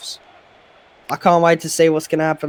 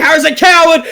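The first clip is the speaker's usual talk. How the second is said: excited shout; 10 semitones higher, 6 dB louder than usual. Acted. Big turnover called out.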